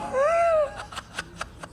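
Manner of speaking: a sharp high burst of laughter; high cackle. Real, not acted. Streamer cracking up at own native joke. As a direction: crack up at the punchline.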